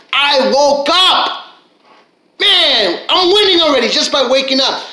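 high-pitched triumphant cry; exultant. Acted. Speaker rejoicing at just waking up, exultant. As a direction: celebrate it like a big win.

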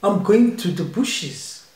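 put-on African accent. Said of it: "African accent".

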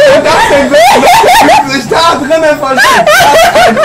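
shocked shout. Real, not acted. Player finding the hidden monster, shocked shout. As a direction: shriek in real surprise.

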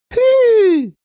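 shrill high call to the engine; a golden mannikin calling out loud and high; little golden man urging on his train; loud whistle-call. Acted. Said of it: "machte das Goldmännlein".

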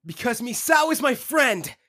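loud, firm declaration; determined shout. Acted. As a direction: shout out his resolve. Declaring resolve.

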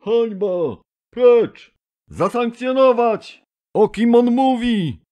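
very high, loud roaring crowd voices; angry assembly shouting at the speaker. A narrator's reading. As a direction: shout them down from the benches. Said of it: "W krąg huczało".

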